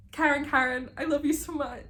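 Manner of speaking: high, excited cry of love; fangirl gushing. Real, not acted. Reader gushing to viewers about Karen, high and excited. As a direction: squeal your love for the character.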